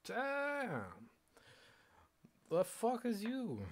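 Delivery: high, loud and slow, stretched disbelief; loud mock outrage. Vlogger yelling at himself on camera, high and loud. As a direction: yell it up high like you can't believe him.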